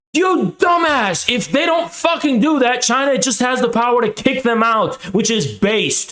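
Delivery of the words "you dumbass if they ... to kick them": angry shout. Real, not acted. To duped populists, a real angry higher shout. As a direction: blast him for falling for it. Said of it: "I'm being angry about this".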